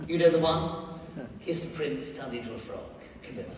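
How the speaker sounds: usual speaking voice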